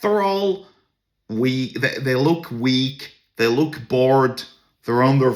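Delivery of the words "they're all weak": normal talking voice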